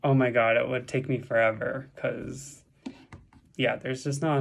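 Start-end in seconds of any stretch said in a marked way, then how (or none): none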